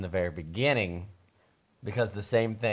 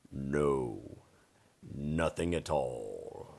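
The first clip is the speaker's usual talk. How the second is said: low, slow dragon dad; a lightly voiced gentle dad dragon; 7 semitones lower, 6 dB quieter, much slower than usual.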